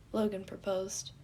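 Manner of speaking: ordinary delivery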